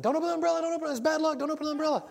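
high, loud, frantic pleading; mock-panicked superstitious cashier yelling; panicked store clerk. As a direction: squeal it like a clerk flipping out. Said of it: "flips out".

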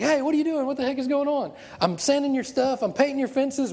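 put-on high-pitched indignant kid complaint; mock exasperated whining; frustrated. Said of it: "Daniel was getting frustrated".

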